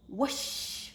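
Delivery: a bright little whoosh for the star; whoosh sound. Acted. Narrator makes the new star's whoosh, airy and bright.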